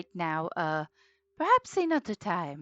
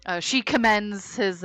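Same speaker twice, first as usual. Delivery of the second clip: ordinary talk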